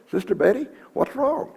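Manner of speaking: high gentle pastoral voice; gentle concern. Retold or imitated. Preacher voices himself to widow.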